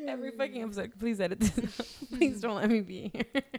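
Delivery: words tumbling out between real giggles; laughing. Real, not acted. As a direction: joke through your own laughter. Laughing about asking for edits.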